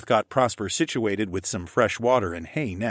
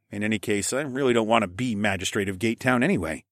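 ordinary delivery, nothing marked

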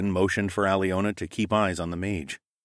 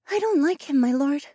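wary bird companion. A narrator's reading. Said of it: "she said into my ear".

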